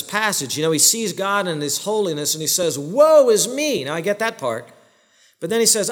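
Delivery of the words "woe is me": 1 anguished cry. Retold or imitated. Isaiah's cry before God.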